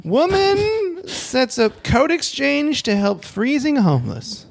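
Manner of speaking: high, slow headline announcement; announcer voice. Acted. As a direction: announce it like a show host. Announcing next story.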